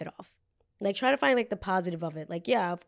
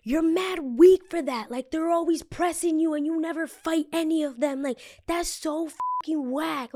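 high, drawn-out, loud-starting taunt; confrontational; 9 semitones higher, 3 dB louder, slower than usual. Retold or imitated. Drunk woman's friend getting in storyteller's face, confrontational. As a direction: act out someone getting in your face, pitched way up. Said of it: "comes up and gets in my face".

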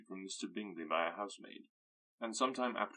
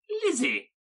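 very high, loud scolding call; mother snapping at her daughter; sharp cry; 11 dB louder than usual. Acted. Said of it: "cried her mother".

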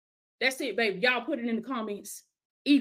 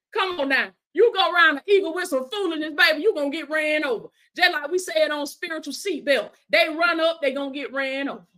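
impassioned preacher declaring scripture; rally the faithful with commanding conviction; fervent preaching; 4 semitones higher, 3 dB louder than usual. Real, not acted. Preaches a warning.

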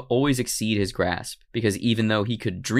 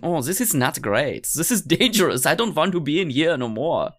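put-on whiny comic German accent, pitched up; 4 semitones higher than usual. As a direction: complain in mock German English. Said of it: "I don't know why he has a German accent".

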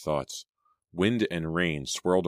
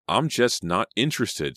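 higher-pitched, emphatic comeback; sharp retort; 5 semitones higher, 4 dB louder than usual. A narrator's reading. Selena rejecting Danny, sharp retort. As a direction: shoot it straight back. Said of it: "Selena shot back".

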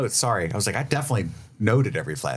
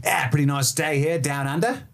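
put-on Australian accent; 5 semitones higher than usual. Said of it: "are they like".

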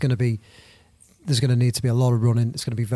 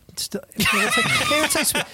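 laughing exasperation; 13 semitones higher than usual. Real, not acted. Laughing while ranting about Heskey.

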